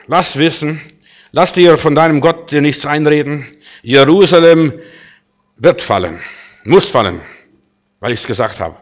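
laut und höher, bis Jerusalem fast gerufen; drohende Botenrede. Acted. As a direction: den König einschüchtern, Jerusalem hinausschmettern. Drohung des Assyrers an Hiskia. (Drohbote zu Hiskia, laut und drohend)

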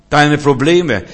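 loud, punched preacher's emphasis; emphatic preaching. Real, not acted. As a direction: hurl the words at the congregation. Preacher hammers home the key point. (laut und eindringlich gepredigt)

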